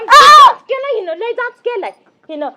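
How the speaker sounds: a burst of loud shouting; loud complaint. Acted. Wife shouting to her husband about the mother-in-law. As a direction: shout out the grievance.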